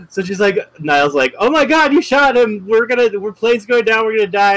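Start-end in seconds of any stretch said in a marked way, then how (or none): 1.4-4.6 high, panicked rush of words; freaking out. Retold or imitated. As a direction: retell her panic as the plane falls. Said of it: "but she's still freaking out".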